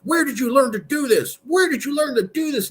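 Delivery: exaggerated imitation of a scolding parent; accusing dad from an old ad. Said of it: "his dad comes in and goes".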